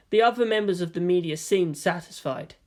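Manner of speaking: ordinary delivery, nothing marked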